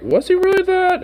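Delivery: high, doubting question; mock partygoer realising he was fooled; suspicious partygoer. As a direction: pitch it up in sudden suspicion. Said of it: "eventually they're gonna be like".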